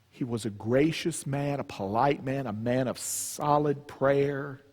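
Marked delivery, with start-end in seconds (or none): none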